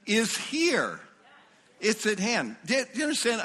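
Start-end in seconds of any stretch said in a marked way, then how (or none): none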